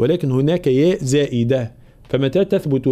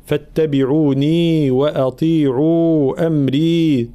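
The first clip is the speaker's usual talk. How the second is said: slow chant, a touch higher; Quran recitation; 3 semitones higher, much slower than usual. Shown as a demonstration. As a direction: recite with even natural madd. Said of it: "في قوله تعالى". (تلاوة مجودة بمدود متساوية)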